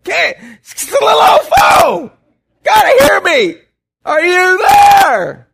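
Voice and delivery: very high, loud laughing shout down the line; yelling into the phone. Retold or imitated. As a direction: bellow to be heard far away. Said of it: "that's how I get to feeling like this".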